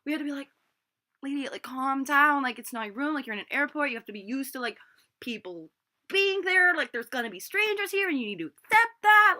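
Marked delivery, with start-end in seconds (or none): 1.2-9.4 exasperated retold scolding. Acts out scolding the drunk woman.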